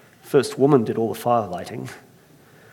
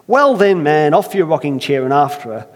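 louder Irish order; commanding Irish; 5 dB louder than usual. Acted. Earthmaker ordering first man out in an Irish accent. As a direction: chase him off his chair. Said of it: "I can't help but try it".

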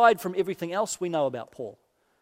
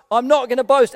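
the third refusal, louder and insistent; emphatic I'm not gonna boast; firm refusal; 4 semitones higher, 7 dB louder than usual. Shown as a demonstration. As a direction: put your foot down on the last one. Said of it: "Paul has been saying".

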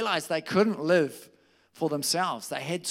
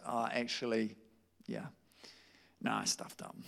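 sheepish admission; 6 semitones lower, 9 dB quieter than usual. Retold or imitated. Peter realizing his failure.

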